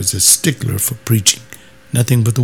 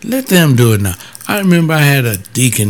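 ordinary delivery

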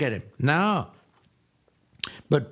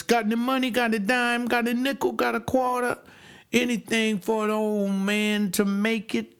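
higher pleading beggar's voice, raised pitch; begging old man; 6 semitones higher than usual. Retold or imitated. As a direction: beg for a coin like a poor old cripple. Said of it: "begin asking for alms".

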